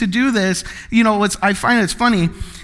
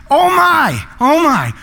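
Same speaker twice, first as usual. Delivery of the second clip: worked-up, higher-pitched flurry of generosity; eager giver; 4 semitones higher than usual. Acted. Acting the giver at a dropped pizza, excited. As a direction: build from flustered concern to a big excited offer. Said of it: "You're a giver".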